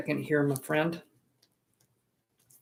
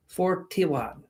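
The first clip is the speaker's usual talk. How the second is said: "Forty-one" in a vague foreign put-on accent; odd put-on accent; 3 semitones higher than usual. Shown as a demonstration. Streamer repeating a viewer's number in a mystery put-on accent. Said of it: "I don't know what accent that is, but it's offensive".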